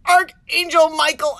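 loud, high mock plea to heaven; hammed-up invocation; calling out. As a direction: call on an angel at full voice. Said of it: "I did call out".

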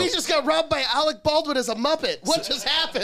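high, loud bewildered victim; shrieking mock victim after a heist. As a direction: play a stunned robbery victim.

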